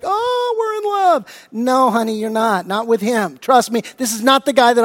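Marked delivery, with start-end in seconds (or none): 0.0-1.5 put-on much higher, dreamy swooning girl voice; lovestruck daughter. Pastor mimics daughter gushing over boyfriend, high dreamy swoon. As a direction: swoon it like a smitten teenager. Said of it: "She'd be like".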